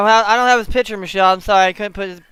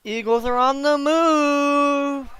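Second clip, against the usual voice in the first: slow, higher drawn-out announcer call; mock announcer; 6 semitones higher, slower than usual. Joking like a TV announcer as the Eagles drive. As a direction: mimic a TV play-by-play call. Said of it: "repeats in broadcaster manner".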